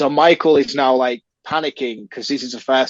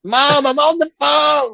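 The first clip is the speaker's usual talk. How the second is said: high, slow whiny yell at mum; a stroppy teenager calling downstairs; whiny teen yell; 13 semitones higher, 5 dB louder, slower than usual. Retold or imitated. Teen on the phone yelling at mum to hang up, high whine.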